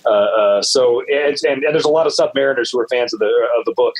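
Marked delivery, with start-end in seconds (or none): none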